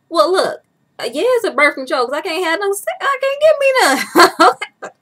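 loud, high-pitched comic protest; squealed complaint, played for laughs; mock exasperated outburst. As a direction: wail it in mock outrage, breaking into laughter. Acted outburst at birth control.

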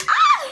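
short piercing loud shriek; sudden high-pitched yelp; loud cry of alarm; high scream. Real, not acted. Screams after the failed prank.

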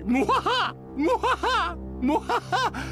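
high, hammy mwahaha cackle; evil villain laugh. Acted. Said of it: "the muhahas aren't really as fun".

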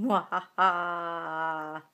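low slow villain cackle; evil laugh. Acted. Evil laugh after spooky greeting.

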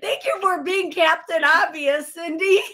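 high, laughing, mock thanks; laughing sarcasm. Real, not acted. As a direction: tease while laughing. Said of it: "I'm laughing 'cause I'm hella nervous".

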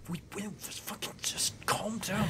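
soft, unvoiced-sounding hush; hushed sibling aside. Yotul to bored Kotul, hushed and exasperated. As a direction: hiss it quietly to a restless sister. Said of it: "Yotu's just like".

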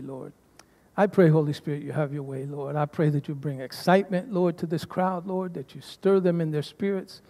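quiet, low-voiced prayer. Pastor praying softly for the crowd, hushed prayer. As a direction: pray softly over the congregation.

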